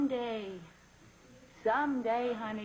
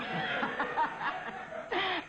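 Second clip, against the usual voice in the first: self-mocking laugh; 5 semitones higher than usual. Mother laughs at own nagging joke.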